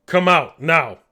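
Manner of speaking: forceful shouted demand; big guy shouting. Acted. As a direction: yell an order at those inside. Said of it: "they hear the big guy shout".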